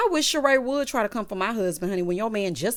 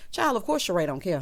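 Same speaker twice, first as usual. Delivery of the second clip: ordinary delivery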